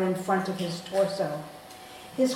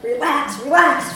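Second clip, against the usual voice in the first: loud, higher urgent yell; screaming; 6 semitones higher, 8 dB louder than usual. Retold or imitated. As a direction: yell it close in her ear. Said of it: "is screaming into my ear".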